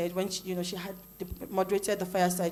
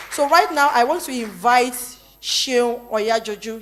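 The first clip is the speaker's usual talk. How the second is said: calling out; 4 semitones higher, 7 dB louder, slower than usual. Real, not acted. Host calls up next speaker.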